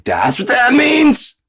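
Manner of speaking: high, loud, slow mock-dumb shout; dumb American. Mocking Americans who misuse words, high loud disbelief. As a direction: squeal it like a clueless American.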